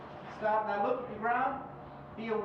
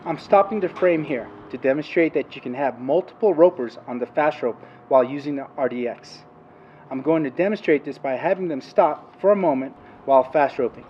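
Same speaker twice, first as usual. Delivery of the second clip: narration; 5 semitones lower, 10 dB louder than usual. Acted. Narrated training video voiceover.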